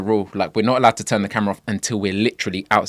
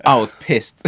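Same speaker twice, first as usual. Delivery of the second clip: said through a short laugh; 5 dB louder, slower than usual. Real, not acted. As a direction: chuckle as you admit it. Laughing retelling missed celebrity.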